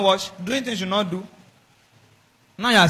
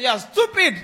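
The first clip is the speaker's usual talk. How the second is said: raised-pitch, heated insult; mock shouting match; 8 semitones higher, 3 dB louder than usual. One quarreller answering an insult, mock-angry. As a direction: throw the insult back in mock anger. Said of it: "Somebody is shouting, and I'm shouting too".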